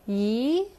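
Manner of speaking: "yi" rising like a question; second tone. Shown as a demonstration. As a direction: lift the end of "yi". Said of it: "bring it up a bit like a question mark".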